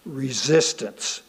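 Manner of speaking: usual speaking voice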